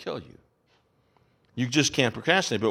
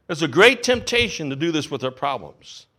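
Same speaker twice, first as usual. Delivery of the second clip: ordinary delivery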